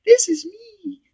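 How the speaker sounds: chirpy high voice he calls his ego; customer service voice. Acted. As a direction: put on your sweet helpful customer service persona. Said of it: "This is the voice I use as my customer service position".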